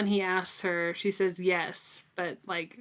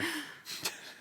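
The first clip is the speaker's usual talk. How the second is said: mock gasp; 10 semitones higher, 11 dB quieter than usual. Reacting to near fire.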